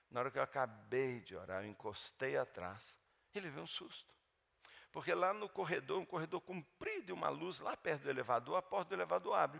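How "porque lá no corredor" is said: normal talking voice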